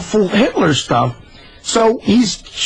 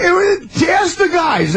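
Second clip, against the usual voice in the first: laughing; 12 semitones higher, slower than usual. Real, not acted. Laughing hard at story.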